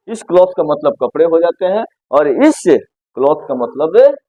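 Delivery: normal talking voice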